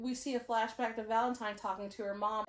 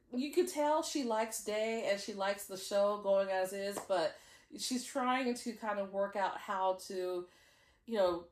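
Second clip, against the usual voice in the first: normal talking voice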